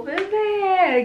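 high loud gush; thrilled squeal. Real, not acted. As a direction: coo "bebê" in delight. Thrilled by makeup swatch.

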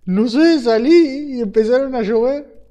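high, loud and amazed; excited shout. Real, not acted. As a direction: still shouting in disbelief. Still shocked by falling mobs.

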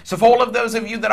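loud, driving prophetic preaching; fervent declaration. Real, not acted. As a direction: decree the blessing with mounting force. Declares blessing over donors.

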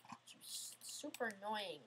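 whispered under the breath, slow; annoyed whispered aside; frustrated whisper. Real, not acted. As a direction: grumble it to yourself in a whisper. Muttering about the stage.

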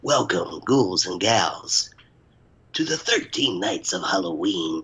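slow, drawn-out spooky host voice; horror host. Acted. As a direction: creep it out like a haunted showman. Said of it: "I like it".

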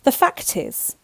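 high-pitched, agitated start. A narrator's reading. Chancellor explaining to the young king, agitated. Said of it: "in an agitated way".

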